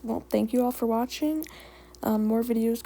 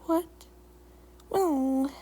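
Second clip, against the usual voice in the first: higher, awkward opening; 3 semitones higher than usual. A narrator's reading. Kitty pet caught staring at Leaf Paw, awkward. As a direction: begin awkwardly, a little high. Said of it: "Skipper began awkwardly".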